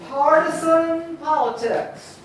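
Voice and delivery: "Partisan politics" slowly, leaning on each first syllable; dictation for scansion. Shown as a demonstration. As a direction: draw out "Partisan politics" in dactyls. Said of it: "I'm gonna say it".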